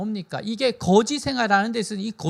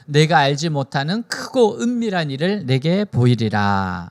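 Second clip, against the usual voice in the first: loud, low-pitched congregational reading; scripture reading; 5 semitones lower, 5 dB louder than usual. Acted. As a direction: read the verses out firmly and evenly, pitched low. Reads scripture aloud with congregation. (힘 있게 낮은 음으로 성경 봉독)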